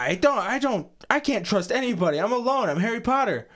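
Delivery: high-pitched mocking lament; Harry Potter. Fan mocking the hero's trust issues, high melodramatic whine. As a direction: wail it mockingly, pitched well up.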